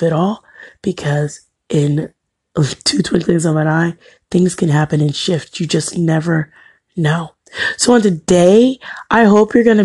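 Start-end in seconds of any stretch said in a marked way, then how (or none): none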